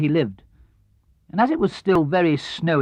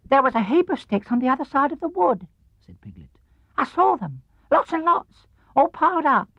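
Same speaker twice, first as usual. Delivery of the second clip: very high excited squeaky voice; an eager little piglet reporting; 11 semitones higher than usual. Acted. Piglet tells Pooh about the sticks, high squeak.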